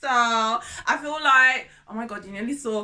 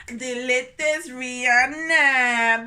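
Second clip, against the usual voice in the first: showy diva sing-song; 7 dB louder, slower than usual. Pretend.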